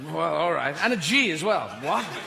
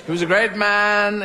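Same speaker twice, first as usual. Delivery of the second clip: louder mock wail of grief over the coffin; theatrical grieving lament; hammed-up mourner; 2 semitones higher, 6 dB louder than usual. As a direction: overdo the tragic mourner. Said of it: "slightly more G'd up".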